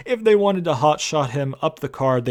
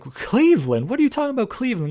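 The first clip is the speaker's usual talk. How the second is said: mock incredulous; 10 semitones higher, faster than usual. Retold bickering, incredulous Morrison.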